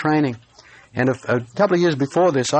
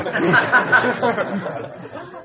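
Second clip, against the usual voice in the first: a high, bright burst of laughter to open; warm laugh; 9 semitones higher than usual. Real, not acted. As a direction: laugh with the congregation. Laughing audience-facing preacher.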